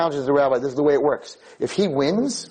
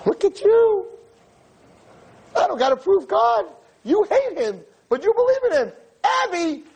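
triumphant exclaiming. Retold or imitated. Rabbi triumphantly tells the girl she believes in God. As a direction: crow in triumph. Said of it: "So I said to her".

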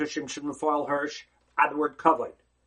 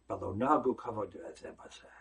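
quiet, low restatement; 9 semitones lower, 6 dB quieter than usual. Real, not acted. Rabbi quoting the Talmud again.

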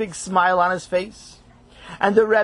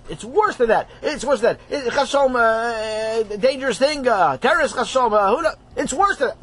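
higher, breathless over-the-top alarm; frantic dramatic; 5 semitones higher than usual. Acted. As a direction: ham up the catastrophe. Rabbi Feingold frantic about worse news.

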